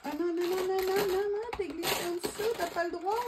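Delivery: high, playful telling-off; mock scolding. Real, not acted. Scolding a slipped ring.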